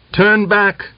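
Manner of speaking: high-pitched order to leave; commanding call. A narrator's reading. Old man turning riders away at his gate, calling out. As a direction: call out a sharp rise in pitch.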